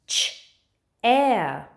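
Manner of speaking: "Ch-air" split into two sounds; sounded out. Shown as a demonstration. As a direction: say "ch", pause, then a long "air". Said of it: "how many sounds are in chair?".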